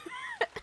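short high-pitched laugh; high squeal laugh. Real, not acted.

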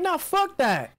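retold angry refusal. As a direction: shut the peacemaker down, high-pitched.

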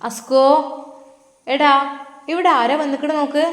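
high, loud call to wake her son; mother calls her son to see the guest. Acted. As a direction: raise your voice so he hears from the bedroom.

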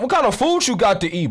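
normal talking voice

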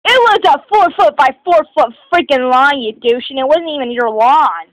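mock outraged correction, voice jumping up loud; retold angry outburst. Student raging in their head at a classmate, angry and loud.

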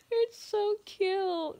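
high, slow cooing; gushing. Real, not acted. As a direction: coo over something adorable. Gushing over scene.

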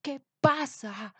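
king crying out in panic; alarmed shout. A narrator's reading. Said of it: "gritó Anacreón".

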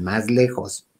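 ordinary talk, nothing marked

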